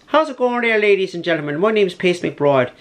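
lively, high-pitched mock Irish accent; cheery Irish voice greeting the crowd. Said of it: "I was giving Irish accent to Pace".

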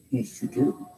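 soft unvoiced whisper soothing the dog; tender whisper. Real, not acted. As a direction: whisper comfort to a frightened pet.

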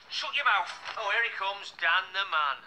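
very loud, belligerent jeering; shouted taunt. Acted. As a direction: bellow the insult across the room. Taunting in a pub confrontation.

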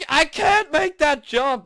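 high, loud, drawn-out complaint; exasperated yell. Real, not acted. Player missing a jump, exasperated yell. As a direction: wail it in gamer frustration.

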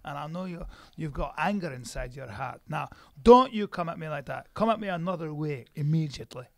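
proud Scot scolding someone; Scottish accent. Acted. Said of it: "Your Scottish voice".

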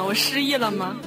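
ordinary delivery

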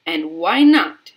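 very loud, drawn-out demand; loud, slow indignant question; shrieked challenge. A narrator's reading. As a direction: shriek back for an answer. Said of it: "shrieked Missus Gloop".

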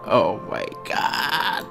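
normal talking voice

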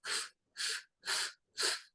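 soft puffed ff sounds; gentle ff snorts; quiet hedgehog snort. Shown as a demonstration. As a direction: puff air quietly like a hedgehog. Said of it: "Їжачок пофиркав тихо".

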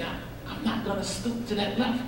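very soft, almost under the breath; hushed resolve. Retold or imitated. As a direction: say it softly to yourself, steady. Resolve spoken hushed in sermon.

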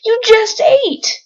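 high, slow disbelieving voice; incredulous. Real, not acted. Player disbelieving her Sim is hungry after eating, high slow incredulous voice. Sim asks for food again.

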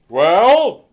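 big loud jolly Santa voice; booming Santa. A narrator's reading. Narrator voicing Santa to the elf, booming. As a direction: boom it out like Father Christmas. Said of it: "boomed Santa".

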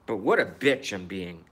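ordinary delivery

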